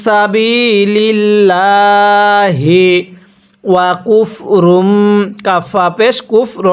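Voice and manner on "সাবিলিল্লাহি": সাবিলিল্লাহি drawn out slowly with a rising end; slow recitation. Shown as a demonstration. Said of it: "তাহলে". (ধীরে টেনে সাবিলিল্লাহি)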